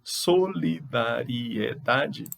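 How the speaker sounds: "Solidariedade" drawn out slowly to count syllables. Shown as a demonstration. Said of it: "Ingredientes tem mais de três". ("Solidariedade" devagar, contando as sílabas)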